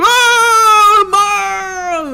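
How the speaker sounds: high wailing cry of Mom; mock crying. Kid crying out to mom, high mock wail. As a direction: fake sob to stop her. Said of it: "I cried".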